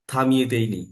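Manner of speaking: ordinary talk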